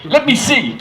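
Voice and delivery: pushy American, high and loud; bad American accent. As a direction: demand it in a hammy American voice. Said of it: "a terrible American accent".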